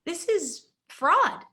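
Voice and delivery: indignant at what he sees; mock-indignant protest; outraged. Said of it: "And I'm like".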